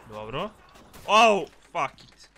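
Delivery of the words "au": high loud ouch; pained yelp. Real, not acted. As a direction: cry out at the hit. Getting hit again.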